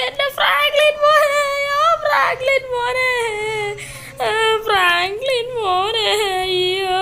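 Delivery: high, slow crying granny lament; wailing granny. Acted. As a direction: drag out each cry for the lost grandson. Granny wailing over dead grandson. (ഉയർന്ന സ്വരത്തിൽ പതുക്കെ കരഞ്ഞു വിലപിക്കുന്ന മുത്തശ്ശി)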